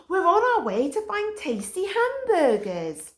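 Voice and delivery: gently steer back to the mission; high reasoning Stick Dog. A narrator's reading.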